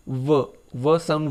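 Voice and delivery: व drawn out slowly, the consonant held clear; slow sound demo. Shown as a demonstration. Teacher slowly models व for the class. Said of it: "व दो ध्वनि है".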